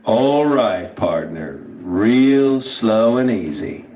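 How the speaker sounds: slow, easy hillbilly drawl; super slow hillbilly drawl. Shown as a demonstration. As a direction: stretch it out like an unhurried hillbilly. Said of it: "ask me in that super slow hillbilly drawl".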